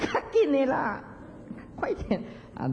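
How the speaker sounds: lightly voiced corpse telling them to hurry; a playful jab, high then breathy; mock dead man urging. Dead person sitting up to tell the chanters, mock impatient. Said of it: "那死人他会起来跟你讲".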